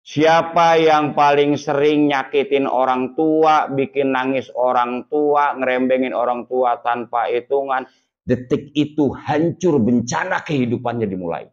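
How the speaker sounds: slow, solemn warning; slow fire-and-brimstone preaching. Acted. Preacher warns congregation.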